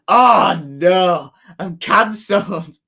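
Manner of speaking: high, loud hammed-up dismay; mock horror. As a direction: ham up being caught out. Mock horror at bad word.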